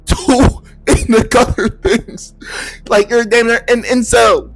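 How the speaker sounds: high, slow laughing jab; high giggling mockery. Real, not acted. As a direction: crack up mid-insult. Laughing at simps.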